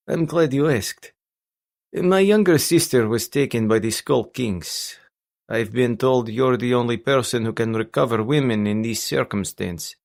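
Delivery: put-on pragmatic, businesslike Russian accent; cool, matter-of-fact Russian voice. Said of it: "He spoke with a pragmatic tone".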